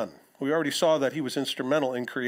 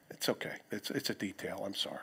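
much softer, lower murmured apology, whispered at the end; quiet aside; 6 semitones lower, 12 dB quieter than usual. Real, not acted. Preacher apologises under his breath to someone nearby, much softer.

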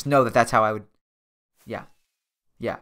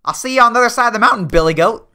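high, fast playful taunt; sing-song jab at a rival; mock taunt; 10 semitones higher, 5 dB louder than usual. Gamer taunting another player on the mountain, high and fast.

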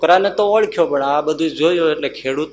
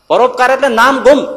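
emphatic proclamation; 9 semitones higher, 5 dB louder than usual. Real, not acted. Preacher stresses the moral point.

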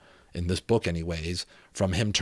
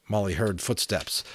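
usual speaking voice

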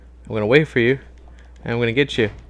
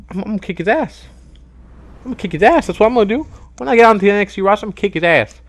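high, worked-up threat; heated vow; 7 semitones higher, faster than usual. Real, not acted. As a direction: vow revenge, fired up. Vowing revenge, worked up.

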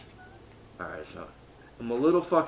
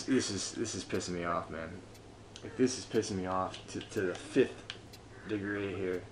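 normal talking voice

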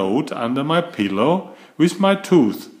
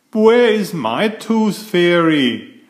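1 stomping demand; 5 semitones higher, 4 dB louder than usual. Acted. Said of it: "I said, stomping my foot".

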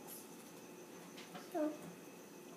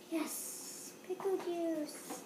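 normal talking voice

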